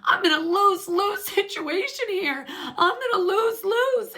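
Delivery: imitating crying. Lisa at the reunion, caught between both sides, mock crying. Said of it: "she starts crying".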